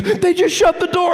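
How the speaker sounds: high mock panic; high frightened report; scared friend. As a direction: gasp it out.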